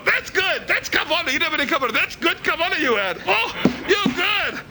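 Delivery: high, slow, fawning praise; over-the-top admirer crying out praise; mock gushing praise. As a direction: lay the flattery on thick. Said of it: "and they go".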